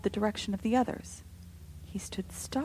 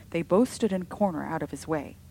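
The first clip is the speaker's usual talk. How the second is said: ordinary delivery, nothing marked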